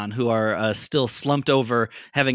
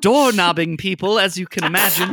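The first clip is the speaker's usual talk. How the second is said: higher, politely sheepish voice; apologetic character; 8 semitones higher, 3 dB louder than usual. Acted. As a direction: apologize with fussy courtesy.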